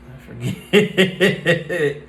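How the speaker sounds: amused admission breaking into laughter; laughing. Real, not acted. Podcaster admits she forgot a line, laughing. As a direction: trail off into real giggles. Laughs at her own forgetting.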